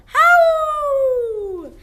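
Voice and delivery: high, loud falling howl; wolf howl. Shown as a demonstration. Teacher shows the class a wolf howl. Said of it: "Wolves howl".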